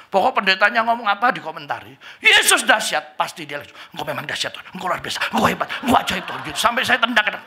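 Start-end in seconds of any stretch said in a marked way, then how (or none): 2.2-3.2 high, loud preacher's cry; preaching shout. Retold or imitated. Pastor crying out to the church, high and loud. 4.0-6.4 fast babbling praise. Retold or imitated. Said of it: "ngoceh terus".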